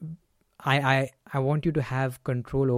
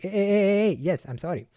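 matching the captain's energy; 6 semitones higher than usual. Shown as a demonstration. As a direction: rise up to the angry captain, then apologise. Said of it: "match that energy".

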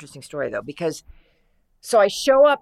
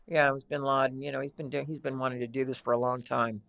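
low, even impression of a friend; cool, low-voiced know-it-all; Kristen Johnson; 4 semitones lower, 4 dB quieter than usual. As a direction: keep it down low and nonchalant. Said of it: "she has that low voice".

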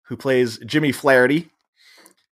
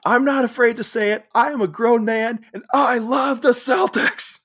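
hammed-up declaration; 13 semitones higher than usual. Grown man declaring Celtics love.

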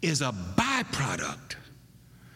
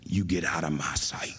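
low, cold whisper of a father sending his son away; whispered dismissal; 9 semitones lower than usual. Retold or imitated. Father ordering his son out of sight, in a whisper. He'd say something like.

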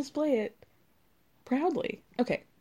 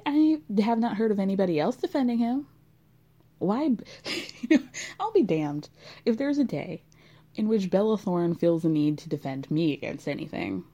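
ordinary talk

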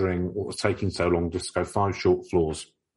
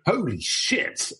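shocked exclamation; 11 semitones higher, much slower than usual. Real, not acted. Reacts to scary quote.

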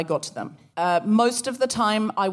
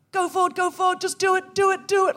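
higher-pitched mock war-movie urging; Platoon battle cry; 10 semitones higher than usual. Psyching herself up to not swerve, mock soldier urging. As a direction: gee yourself on like a soldier charging. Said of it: "like I was in Platoon".

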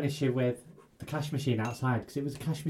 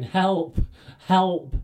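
calling for help, a bit higher and louder; mock shouting; 5 semitones higher, 5 dB louder than usual. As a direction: cry out for help, half-hearted. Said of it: "he's shouting".

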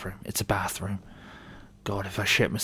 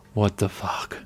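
sudden louder startled outburst; startled exclamation; 6 dB louder, slower than usual. Real, not acted. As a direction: blurt it out in shock. Sees someone standing there.

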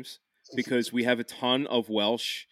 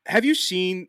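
ordinary talk

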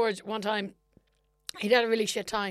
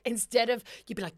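ordinary talk, nothing marked

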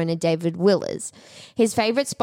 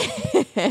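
high laugh. Real, not acted. Laughing at odd title.

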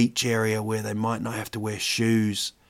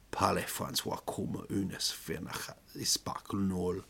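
quiet, breathy French insult murmur; mock French; 3 semitones lower, 11 dB quieter than usual. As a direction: sneer it in French under your breath. Mock French insult from shop staff.

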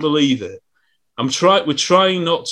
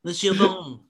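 put-on French accent; 5 semitones higher, 4 dB quieter than usual. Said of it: "Your French".

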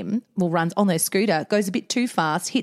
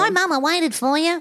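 put-on child voice; 9 semitones higher than usual. Voicing son in a story.

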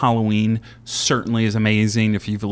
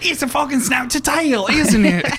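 imitating Fergus. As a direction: ham up the chef, punch the end. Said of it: "He's like".

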